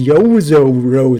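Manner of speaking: YOZORO very slow and loud, every "oh" swelling; bumpy English pronunciation. Shown as a demonstration. Streamer mocks how English people say it, exaggeratedly bumpy. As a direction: exaggerate each bump in "Yozoro". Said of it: "how bumpy that is".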